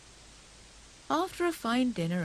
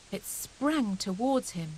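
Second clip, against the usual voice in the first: ordinary delivery, nothing marked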